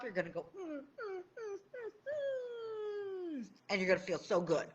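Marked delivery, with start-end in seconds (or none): 0.6-3.5 high, soft blissful humming; blissful hums. Pretend. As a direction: hum as if in total bliss. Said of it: "feel like Precious Pup".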